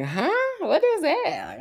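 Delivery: high-pitched mock confusion; puzzled, pitch shooting up; baffled. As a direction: react as if totally thrown. Acts out being thrown by term.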